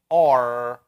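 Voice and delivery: R bent back, American style. Shown as a demonstration. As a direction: curl the tongue for an American R. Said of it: "especially the American one".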